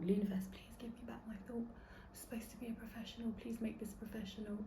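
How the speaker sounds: hushed, pleading prayer; whispered plea. Real, not acted. Podcaster losing her thought, whispering a plea to the universe. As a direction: beg the universe under your breath.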